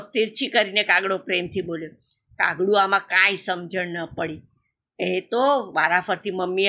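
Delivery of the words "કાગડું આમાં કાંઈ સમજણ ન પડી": usual speaking voice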